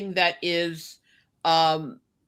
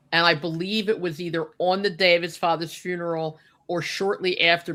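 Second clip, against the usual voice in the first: ordinary talk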